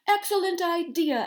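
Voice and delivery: high, loud exclamation; exclaiming. A narrator's reading. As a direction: burst out eagerly. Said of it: "exclaimed Duck".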